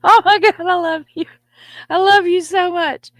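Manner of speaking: high loud swooning; requested gushing. Shown as a demonstration. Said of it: "as requested".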